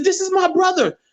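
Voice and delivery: high, tearful announcement; excited. Retold or imitated. Sister telling her mother, high and excited. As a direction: say it high, bursting with joy.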